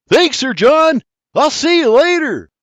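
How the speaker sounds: loud, high call over the shoulder. A narrator's reading. William calling back to Sir John as he runs, loud. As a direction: shout thanks while running off.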